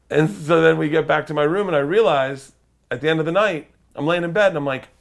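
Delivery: normal talking voice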